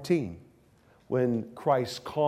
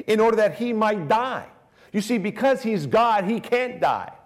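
raised, louder sermon voice, higher pitch; impassioned preaching; 6 semitones higher, 4 dB louder than usual. Acted. Preacher urging the congregation on Christ's humility. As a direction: drive the point home with fervour.

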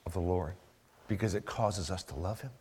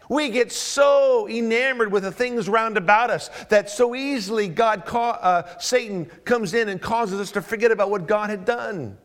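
loud, climbing preacher's list; preaching at full force; 11 semitones higher, 8 dB louder than usual. Acted. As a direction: drive each line higher and harder.

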